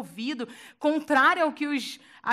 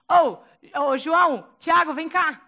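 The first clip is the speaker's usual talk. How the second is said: calling loudly to friends across the water; 3 semitones higher, 8 dB louder, slower than usual. Retold or imitated. Pedro calling his fishing friends to come, loud call. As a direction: shout for João and Tiago to come.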